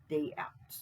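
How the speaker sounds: ordinary delivery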